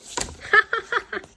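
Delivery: high cackle; mocking laugh. Villains laugh at the boy, high cackle.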